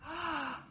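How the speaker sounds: sharp, high gasp; quick intake of breath, pitched high. Real, not acted. Listener reacts to being condensed into a diamond, gasping.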